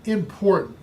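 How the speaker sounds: normal talking voice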